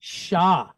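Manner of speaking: "Sha" with a clean sh and open a; sounding out syllable. Shown as a demonstration. Teacher sounds out first syllable for class, clear and deliberate. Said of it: "how do we sound out this first syllable".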